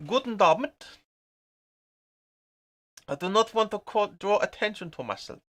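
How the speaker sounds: jokey put-on German accent, pretending to blend in abroad; teasing a mate in Cologne with a mock German accent. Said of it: "talking like this".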